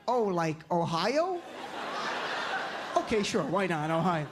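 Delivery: put-on slow-witted Jersey kid's accent; imitated Jersey accent. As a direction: guess in a New Jersey accent. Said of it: "they have that accent".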